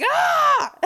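high, loud strained scream; failed belt breaking into a scream; screamed high note. Shown as a demonstration. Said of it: "I'm just screaming it".

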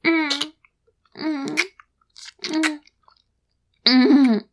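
usual speaking voice

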